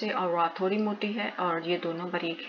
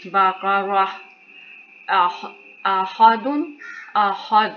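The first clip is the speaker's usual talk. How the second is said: raised, careful recitation of each word; Quran recitation; 2 semitones higher, slower than usual. Acted. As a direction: recite every word clearly for the learners.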